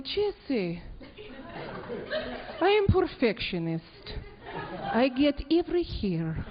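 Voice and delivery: put-on slow, deliberate Russian accent; mock-serious Russian beautician accent; Russian lady. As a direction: imitate a Russian perfectionist, spacing out the words. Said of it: "I have to go to a Russian lady".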